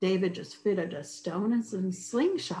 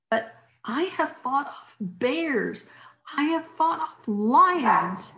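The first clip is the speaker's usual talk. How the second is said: proud boast; 7 semitones higher, 3 dB louder, slower than usual. Acted. David boasts of bears and lions.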